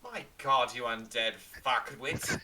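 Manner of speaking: loud, acted outburst of exasperation; hammed-up telling-off, landing slowly on the insult; exasperated insult. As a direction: berate the knight loudly. Player berates the knight in character.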